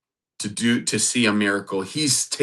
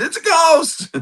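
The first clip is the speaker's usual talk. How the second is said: shrieked much higher, voice jumping up in panic; terrified cry. Acted. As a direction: cry it out like frightened men seeing a ghost. Disciples cry out in fear.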